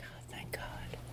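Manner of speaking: whispered thanks in relief; relieved whisper. Retold or imitated. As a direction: breathe out relief. Relief on rescue call.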